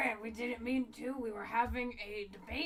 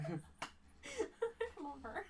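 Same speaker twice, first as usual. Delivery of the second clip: laughing; 11 semitones higher, 7 dB louder, much slower than usual. Real, not acted. Laughing while saying it.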